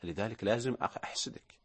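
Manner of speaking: low, hushed jealous confession, ending in a whisper; envious man. Pretend. Host playing an envious colleague of the presenter Hasan, low and hushed. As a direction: grudge muttered quietly.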